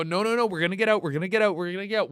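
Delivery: rapid, repetitive pep talk; hurried panicky mantra; frantic self-reassurance. Retold or imitated. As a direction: gabble it fast to steady yourself.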